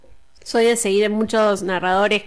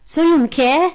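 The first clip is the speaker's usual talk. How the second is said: lightly acted boy wizard, close to own voice; a touch of radio-play surprise; Harry; 2 semitones higher, slower than usual. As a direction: answer as an incredulous young hero, barely disguised. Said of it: "me tenés que contestar como Harry".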